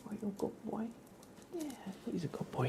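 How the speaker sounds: ordinary talk, nothing marked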